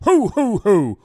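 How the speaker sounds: loud booming Santa laugh; hearty Father Christmas ho ho ho; jolly ho ho ho. Acted.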